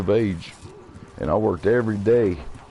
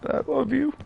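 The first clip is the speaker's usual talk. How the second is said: pained, emotional; 4 semitones higher, 5 dB quieter than usual. Real, not acted. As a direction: voice jumping high with hurt. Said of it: "There's a lot of pain in me".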